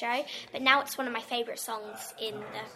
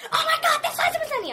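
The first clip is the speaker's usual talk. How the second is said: imitating mum, much higher, louder and fast; starstruck mum; 9 dB louder, faster than usual. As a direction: squeal it like a starstruck mother. Mum spotting a star.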